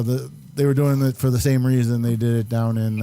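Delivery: normal talking voice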